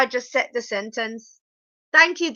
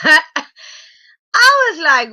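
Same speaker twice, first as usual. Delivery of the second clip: laughing; 5 semitones higher, 5 dB louder, much slower than usual. Real, not acted. Laughing at the made-up word.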